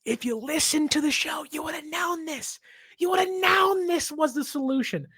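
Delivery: high, strained yell at the team; rising frustrated rant that breaks out; told-you-so outburst; exasperated shout. Real, not acted. Ranting at the Cardinals' failure.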